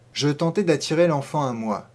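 plain reading voice

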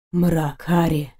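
low, restrained growl through clenched teeth; strangled growl. A narrator's reading. Knight to heroine through clenched teeth, a low strangled growl. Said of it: "прорычал Дайрен сдавленно сквозь плотно сжатые зубы".

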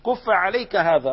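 demonstrating the hadith gesture; pointing emphasis. Shown as a demonstration. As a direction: stress on كف, كف said with a falling punch. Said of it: "لما بقول لك: 'هذا'".

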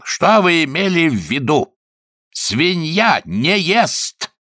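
high, aggrieved lord, stretching words; indignant complaint. A narrator's reading. Lord confronting young man over pig, high indignant complaint. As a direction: wail the accusation high and drawn-out.

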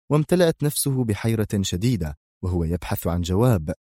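ordinary delivery

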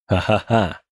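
quiet sinister ha-ha-ha; low gloating chuckle. Acted. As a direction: chuckle darkly to himself. Gloating over his secret plan.

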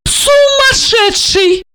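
high, drawn-out alarmed cry; exclaiming. A narrator's reading. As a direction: exclaim it in exasperated disbelief. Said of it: "воскликнула Ольга".

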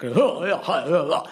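floppy public school cockney, consonants dropped; consonant-free mock cockney. Panellist mimics Blair's floppy speech, consonant-free public school cockney. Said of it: "consonant-free, floppy public school cockney".

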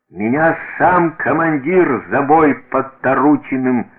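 self-important tirade; boastful soldier. A narrator's reading. Soldier boasting loudly to host.

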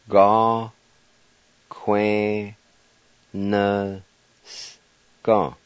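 Ga, que, na, s-, ga, with the s- clipped almost silent; syllable by syllable. Shown as a demonstration. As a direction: space out each syllable. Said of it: "Word number four".

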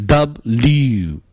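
"W" as double-U merged into dub-lyu; careful two-part "W", said twice; run-together W. Shown as a demonstration. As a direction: glide "W" from dub into a long lyu. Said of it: "把它念顺口就成了".

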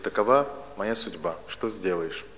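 normal talking voice

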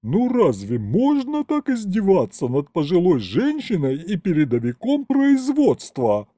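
high, indignant tirade; outraged roar. A narrator's reading. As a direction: rail at him like a wronged matron. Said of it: "взревела она".

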